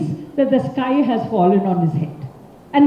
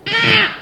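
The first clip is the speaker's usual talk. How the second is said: scream; 9 semitones higher than usual. Acted. Man stung by bee screams.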